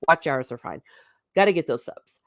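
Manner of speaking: ordinary talk, nothing marked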